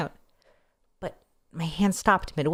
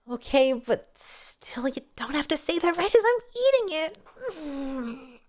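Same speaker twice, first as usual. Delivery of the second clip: high, slow whiny protest; whiny complaint; 9 semitones higher, slower than usual. Acted. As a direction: whine it up high. Complaining while eating.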